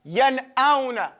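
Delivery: yan auna said slowly, noon sounded; slow, clear pronunciation. Shown as a demonstration. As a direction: draw out yan auna, each part clear. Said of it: "You see that?".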